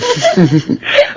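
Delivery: short sly chuckle; wicked laugh. Acted. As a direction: laugh like a schemer. Villain couple laughing.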